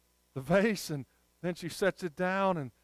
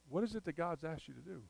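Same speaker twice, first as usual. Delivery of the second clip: much softer, dropped right down; gentle insistence; 12 dB quieter than usual. Real, not acted. Preacher returning the question to a doubting listener, soft insistence. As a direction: answer the objection quietly. Soft repeat answering the objection.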